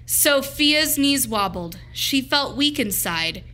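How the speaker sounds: plain reading voice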